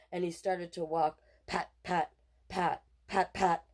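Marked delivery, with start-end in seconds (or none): none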